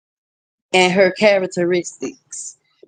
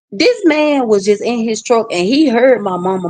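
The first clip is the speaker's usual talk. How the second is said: ordinary talk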